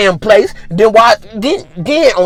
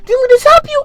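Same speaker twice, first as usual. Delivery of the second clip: very high, squeaky mock voice; incredulous coworkers. Coworkers asking the fallen-behind worker, high incredulous mockery. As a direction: pile up questions in high falsetto. Acts coworkers' baffled questioning.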